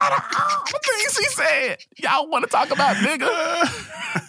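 high wail of disbelief, slowing at the end; mock outrage. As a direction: wail it in hammed-up exasperation. Mock outrage over the word.

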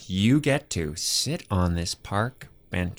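slow grand presentation. Acted.

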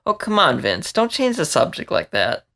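high, whiny pout at him. Acted. Emma pouting at Vince for dodging, high and whiny. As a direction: sulk the complaint like a spoiled kid. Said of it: "Emma pouted".

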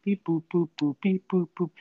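high mock beep-boop tones; keypad beeps. Imitating phone keypad tones.

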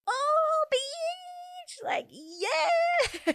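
very high, drawn-out fangirl shriek; ecstatic squeal. Retold or imitated. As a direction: squeal with delight, stretching the words. Squealing her reaction to the statement.

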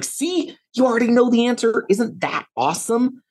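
put-on high, bright-eyed praise of a child's answer; delighted praise. Mom praising her nine-year-old son, high excited praise. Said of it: "I was like".

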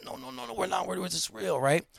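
high, soft, rapid insistence; urgent pleading. Retold or imitated. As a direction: imitate staff begging to be believed. Staff plead again.

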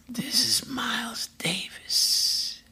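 slow, hoarse whispered rasp. Retold or imitated. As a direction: drag it out in a raspy whisper. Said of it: "I hear somebody go".

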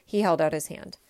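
plain reading voice

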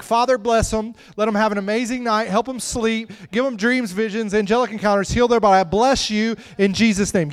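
high, fervent bedtime prayer; praying parent. Retold or imitated. Parent praying over kids at night, high and fervent. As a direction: lift the voice into fervent blessing. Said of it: "you'd be like".

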